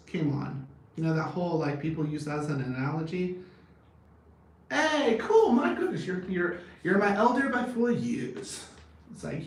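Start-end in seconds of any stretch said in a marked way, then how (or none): 4.7-8.7 much higher and louder burst of delight; real excited outburst, voice jumping high and loud. Real, not acted. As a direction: greet it like great news, pitch leaping up. Excited reply to viewer's age.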